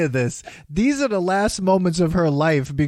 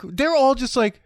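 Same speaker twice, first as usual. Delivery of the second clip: ordinary talk, nothing marked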